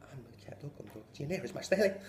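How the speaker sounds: rattled-off Irish sentence. Retold or imitated. Teacher repeating the Irish phrase, rapid. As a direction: rattle it off at speed. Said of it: "That bitch said it fucking faster".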